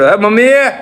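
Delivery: high, rising call to mum. Real, not acted. Son pestering mother for permission, calling out. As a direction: raise the voice to get her attention.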